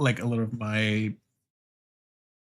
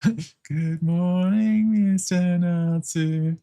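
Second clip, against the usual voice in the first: slow singsong classroom greeting, higher at first; class in unison; 7 semitones higher, much slower than usual. Acted. Mock class greeting teacher and everyone, drawn-out singsong chant. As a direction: stretch each word like schoolchildren in chorus. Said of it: "the class in unison".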